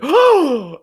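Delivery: loud, high-pitched "oh!"; exaggerated aghast "oh"; mock shocked gasp. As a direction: gasp out a hammed-up cry of shock. Mimics Professor X's shocked gasp.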